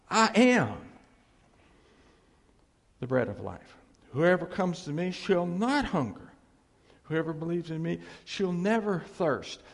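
full-voiced proclamation; proclaiming. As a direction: preach it to the back row. Preacher proclaiming Jesus' words.

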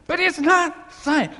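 it's punched high and loud, slowed; loud punchline. Acted. Preacher closing his point, loud and slow. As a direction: land the line loud and slow.

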